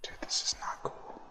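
voiceless, hushed dismay; uneasy whisper. Real, not acted. Player creeping through an eerie game, uneasy whisper. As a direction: whisper it as if something might hear.

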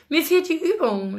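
light, high-pitched reply with a smile; smiling. A narrator's reading. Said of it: "lächelte er".

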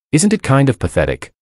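plain reading voice

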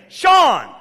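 first sharp attempt to rouse a snorer; calling to wake someone. Calls to wake snoring friend.

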